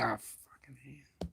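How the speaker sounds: slow, breathy whispered groan; whispered curse. Retold or imitated. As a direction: mutter it under your breath. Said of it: "I'm gonna say".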